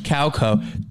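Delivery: usual speaking voice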